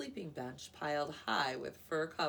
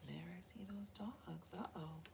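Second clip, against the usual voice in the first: hushed aside; 8 dB quieter than usual. Real, not acted. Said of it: "reader aside to children".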